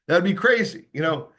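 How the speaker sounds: ordinary talk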